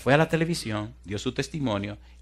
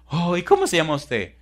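higher-pitched, eager question; imitated trapped woman; 7 semitones higher, 6 dB louder than usual. Rescued woman asks the fireman's name, high and eager. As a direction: ask in a raised, wondering voice.

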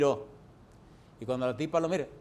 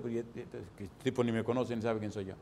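puzzled murmur; 5 semitones lower, 7 dB quieter than usual. Retold or imitated. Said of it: "y digo".

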